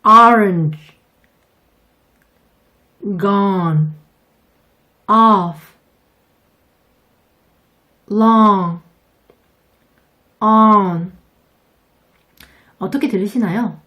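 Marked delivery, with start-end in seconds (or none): 0.0-11.1 "Orange", "gone", "off", "long", "on" loud with the jaw-dropped aw vowel; said loud and clear for repeating; loud teacher model of the aw sound. Shown as a demonstration. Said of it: "연습 한번 해볼게요".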